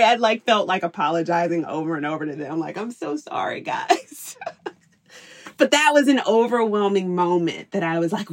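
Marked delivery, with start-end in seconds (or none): 2.8-4.4 high, breathy sorry trailing to a whisper; pleading apology. Retold or imitated. Mother apologizing to her kids after yelling, high and pleading. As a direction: beg their forgiveness.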